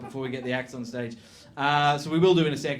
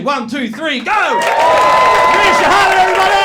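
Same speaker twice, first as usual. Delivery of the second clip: announcer shout; 13 dB louder than usual. Real, not acted. MC introducing [name] to the crowd, shouting. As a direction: bellow the act's name to the room.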